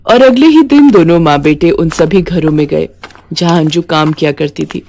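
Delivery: usual speaking voice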